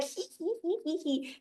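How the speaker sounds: high, hushed monkey tittering; cheeky monkey giggle. Acted. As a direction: titter softly and squeakily as a playful monkey. Imitates monkey at play again.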